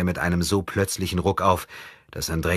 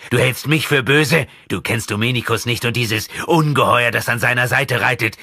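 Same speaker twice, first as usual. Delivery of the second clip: raised, forceful protest, high and loud; emphatic; 7 semitones higher, 6 dB louder than usual. A narrator's reading. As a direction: argue back with sudden force. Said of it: "mit unerwartetem Nachdruck".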